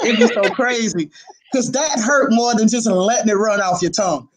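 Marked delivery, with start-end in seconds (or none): none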